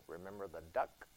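low, hushed, knowing tease; sister's sly taunt. Retold or imitated. Preacher voicing the sister blackmailing her brother, low and sly. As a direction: murmur it low like a sneaky little sister. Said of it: "she goes".

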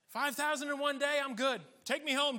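loud, high, hammed-up satisfaction; mock elated. Preacher imagining five thousand saved, mock elated. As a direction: exclaim like your career just peaked. Acts out being done after Pentecost.

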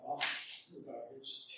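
whispered, disgusted self-talk; hushed grumble at your own work; muttering to yourself. Retold or imitated. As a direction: mutter it under your breath. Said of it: "you just kind of mutter to yourself".

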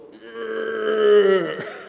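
short self-mocking laugh; embarrassed high laugh. Real, not acted. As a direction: chuckle at yourself. Laughing at own girth.

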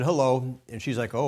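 normal talking voice

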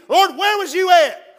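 loud, slow, high plea to the Lord; anguished cry to God. Retold or imitated. Preacher cries out to God in his pain.

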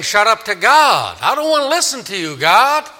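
high, loud mock defiance; insolent, high-pitched refusal; defiant. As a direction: shout God down, pitch jumping up. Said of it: "they're saying".

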